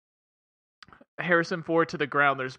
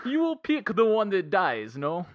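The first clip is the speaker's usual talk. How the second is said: higher Russian villain voice, laughing through it; a Russian bad guy offering a grim choice, in a laughing mock accent; 4 semitones higher, 4 dB louder than usual. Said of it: "that was my Russian accent".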